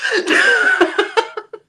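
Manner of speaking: airy laugh up high; high breathy laugh. Real, not acted. Laughing at own failed audition.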